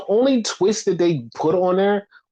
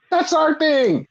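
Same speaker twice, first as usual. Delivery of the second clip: much higher, insisting; exasperated; 12 semitones higher, slower than usual. Retold or imitated. Podcaster retelling his own indignant reply, much higher pitch. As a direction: squeak it out indignantly. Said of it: "I'm like".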